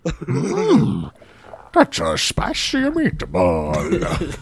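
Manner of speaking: slow, hammed-up Italian accent on spicy meatball; Italian Yoda impression. Host doing Frank Oz as Italian Yoda, slow and hammed-up Italian. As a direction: drag it out like a cartoon Italian. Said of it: "Frank Oz trying to do an Italian voice".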